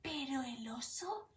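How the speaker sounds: hushed whisper so the bear sleeps on; whispering the refrain to the children. A narrator's reading. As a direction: whisper it so he won't wake. Quiet refrain about sleeping bear.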